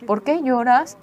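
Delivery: a daughter retells her mother asking why she cries, a touch of surprise. Retold or imitated. Startled, concerned asking. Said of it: "me dijo".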